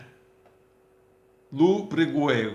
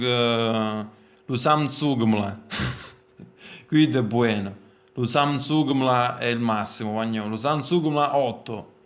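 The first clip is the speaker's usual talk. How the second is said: put-on southern Italian accent. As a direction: sell it like a Naples street vendor. Mock ad in dialect.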